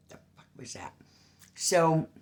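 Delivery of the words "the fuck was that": hushed, unvoiced whisper; real startled whisper; whispered aside. Real, not acted. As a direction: whisper it under your breath, startled.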